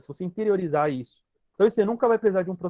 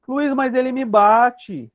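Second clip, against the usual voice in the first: higher, louder, slow pleading complaint; complaining client; 6 semitones higher, 6 dB louder, much slower than usual. Retold or imitated. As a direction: plead your case to the therapist. (cliente reclamando, aguda e lenta)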